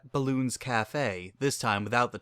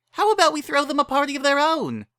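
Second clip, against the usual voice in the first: much higher, bubbly pony voice; eager Pinkie pitching in; Pinkie Pie voice; 5 dB louder than usual. Acted. Pinkie urges friends to help, bubbly.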